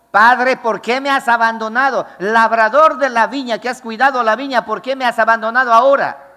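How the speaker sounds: más fuerte y agudo, gritando al Padre; clamor desesperado. Acted. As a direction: clama desde el lagar, desgarrado. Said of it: "la uva estaba gritando". (Cristo clamando al Padre, más fuerte)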